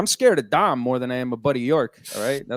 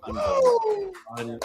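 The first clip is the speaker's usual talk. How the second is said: mock falsetto cheer. Hyping the crowd for Fernando.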